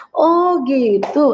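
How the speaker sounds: long drawn-out slow "Oh gitu" rising; a very slow, amazed "Oh gitu"; dawning realization. Shown as a demonstration. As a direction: let the understanding dawn slowly. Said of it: "apa saja buku apa itu".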